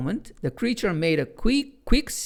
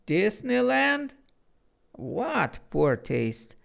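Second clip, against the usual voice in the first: slow, sniffy disdain; lightly voiced offended snob; disappointed tut; 6 semitones higher, slower than usual. A narrator's reading. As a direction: look down your nose. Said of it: "tutted disappointedly".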